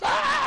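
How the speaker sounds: very high, loud shriek of fright; mock jump-scare scream; startled scream. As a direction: shriek as if startled by a sudden noise. Acts startled jump at machine.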